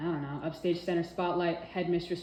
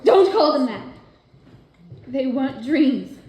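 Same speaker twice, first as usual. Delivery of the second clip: loud, high, slowed angry protest; angry outburst; 7 semitones higher, 14 dB louder than usual. Acted. Peggy angrily correcting Peter about her dreams. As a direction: snap back at him.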